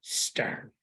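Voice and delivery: hushed, near-whispered stern word; low-volume, whispered sternness. Shown as a demonstration. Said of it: "you gotta be stern".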